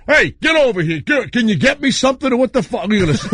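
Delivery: high, agitated comic ordering someone over; pushy high-voiced comedian impersonation; Rodney Dangerfield. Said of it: "He goes".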